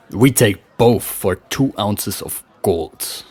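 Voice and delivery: usual speaking voice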